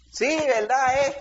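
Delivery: respuesta burlona. Retold or imitated. Niños burlándose del consejo materno.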